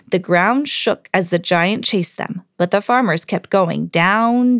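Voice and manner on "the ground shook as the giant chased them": ordinary delivery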